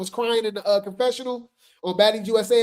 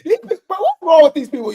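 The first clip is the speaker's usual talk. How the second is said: breaking up, higher and louder through laughter; can't hold it together; laughing; 6 semitones higher, 6 dB louder than usual. Real, not acted. Laughing at the fans to viewers, cracking up. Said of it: "I can't even say this with a straight face".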